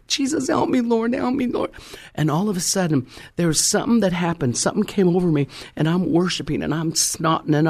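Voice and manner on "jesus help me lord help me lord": much higher pleading cry for help; retold plea, voice lifted high; desperate prayer. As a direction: beg Jesus like you're at the end of your rope. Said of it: "I was just in my natural".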